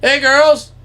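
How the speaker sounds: high, loud singsong hail; imitating dad luring deer; sweet-talking. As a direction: call the deer like old girlfriends. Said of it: "Talk sweet to him".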